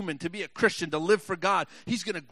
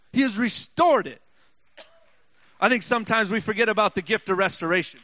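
rising, louder, building preaching shout; full-force preaching; 5 semitones higher, 9 dB louder than usual. Acted. Preacher urging the congregation about restoration, building to a shout. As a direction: drive it home to the congregation, louder and louder.

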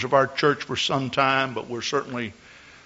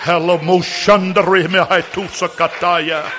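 speaking in tongues; 5 semitones higher, 6 dB louder than usual. Real, not acted.

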